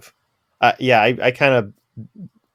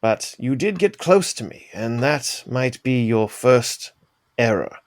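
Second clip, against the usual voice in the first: measured character voice, slowing toward the end; Oliver. Acted. Oliver to his sparring partner, measured in-character warning. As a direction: deliver a composed warning to an opponent. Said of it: "So Oliver will say".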